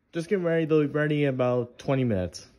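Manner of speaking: calling out. Acted. Cook calls out to friend.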